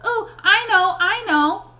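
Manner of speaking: high, eager classroom "ooh, ooh, I know"; a kid bursting to answer first; eager hand-waver. A narrator's reading. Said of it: "jangling her bracelets and going".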